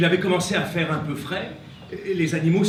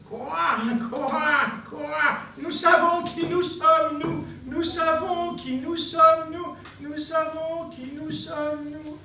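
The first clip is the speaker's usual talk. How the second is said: high, slow croaky chant; frog voice; 10 semitones higher, slower than usual. Acted.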